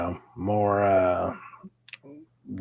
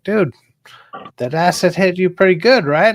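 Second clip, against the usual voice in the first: higher and louder teasing buddy voice; mocking friend; 10 semitones higher, 9 dB louder than usual. Friend teasing him about the acid, higher and louder. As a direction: rib him like a friend who saw it all.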